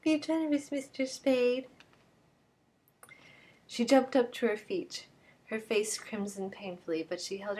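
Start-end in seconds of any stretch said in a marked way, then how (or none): 0.0-1.7 higher, mocking imitation of her throb; mocking her plea. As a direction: mimic her pleading throb sarcastically. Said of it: "that throb you get in your voice".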